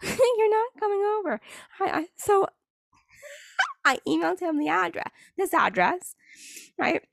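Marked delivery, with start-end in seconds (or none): 0.0-3.8 high, giggly put-down; laughing retort. Real, not acted. Laughing at the guy who demanded her address, high and giggly. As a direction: laugh while shutting him down, way up high.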